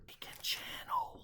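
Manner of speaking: hushed, breathless whisper of a secret; dramatic whisper. Pretend. Retelling what Fane reveals to the crowd, dramatic whisper. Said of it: "Fane says".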